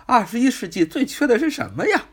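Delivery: high, fast mock movie line; quoted movie line. Teacher quoting a famous film line to the class, high and quick. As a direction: toss out the film quote in a lifted voice. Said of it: "就好像电影中说的".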